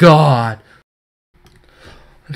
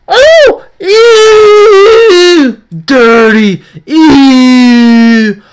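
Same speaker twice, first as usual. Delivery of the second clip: loud high excited yelling; disgusted shout; 11 semitones higher, 9 dB louder, much slower than usual. Real, not acted. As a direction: squeal with gross-out delight. Reacting to a pack pull.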